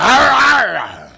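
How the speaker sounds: loud snarling monster growl; shark growl. Retold or imitated. Shark attacking the beachgoer, loud growl. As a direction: roar like a biting beast. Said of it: "He like".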